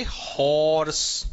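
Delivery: हॉर्स said slowly with a clear breathy h; slow drawn-out horse. Shown as a demonstration. Teacher to students, drawing हॉर्स out slowly. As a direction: stretch हॉर्स out, sounding the h. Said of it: "इसको कैसे पढ़ोगे".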